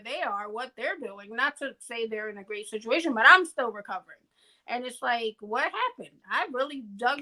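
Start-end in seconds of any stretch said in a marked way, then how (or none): 3.1-4.2 louder and quicker, pressing the point; emphatic repeat. Real, not acted. Stressing she still hasn't recovered, louder and faster. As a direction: hit it hard the second time.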